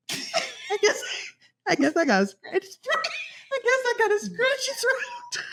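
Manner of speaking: quoting her friend through giggles; laughing quote. Retold or imitated. Friend retelling a friend's excuse, laughing throughout. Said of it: "she said".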